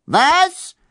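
high shrieked question; shrieking. A narrator's reading. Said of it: "kreischte Jaspara".

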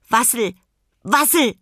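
high, loud penguin calls; calling penguin. A narrator's reading. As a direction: call out across the rooms. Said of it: "rief er".

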